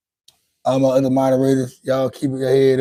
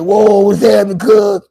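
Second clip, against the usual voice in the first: excited greeting; 7 semitones higher, 8 dB louder than usual. Retold or imitated. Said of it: "and said".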